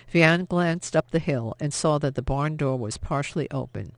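plain reading voice

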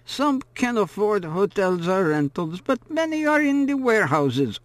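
put-on high, drawling old woman's talk; chatty old woman. A narrator's reading. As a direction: gossip on about the town like a storybook grandmother. Old woman chatting while cooking.

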